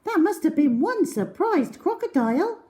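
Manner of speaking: admiring joke; girl teasing admiringly; Cherry impressed. A narrator's reading. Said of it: "said Cherry".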